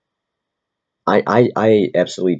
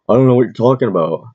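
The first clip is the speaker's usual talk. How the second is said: higher, louder mocking imitation; mocking shithead comedian; 6 semitones higher, 6 dB louder than usual. Fellow wannabe comedian denying the theft, mocking. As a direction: mimic him playing dumb, pitched up and loud. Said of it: "he acted like a shithead".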